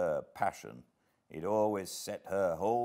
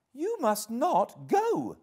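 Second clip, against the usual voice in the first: high, loud, slow insistence; emphatic high-pitched command; energetic exclamation; 6 dB louder, slower than usual. A narrator's reading. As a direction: forbid it with drawn-out energy. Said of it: "she exclaimed energetically".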